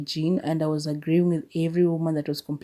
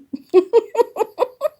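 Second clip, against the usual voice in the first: high-pitched laughing squeal; 4 dB louder than usual. Real, not acted.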